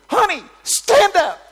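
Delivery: high, slow, booming shout; calling out. Retold or imitated. Preacher calling a granny up from the crowd, shouted. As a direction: holler it across a huge coliseum.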